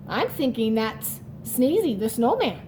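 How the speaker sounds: ordinary talk, nothing marked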